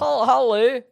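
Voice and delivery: hello pitched high and loud in a put-on accent; mock African accent. Friend repeats his African impression for the others, in a loud put-on accent. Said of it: "listen to it again".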